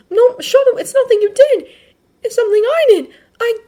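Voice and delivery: put-on very high, loud, earnest boy's voice; Midoriya protesting. Midoriya telling Shoto it is his own doing, urgent.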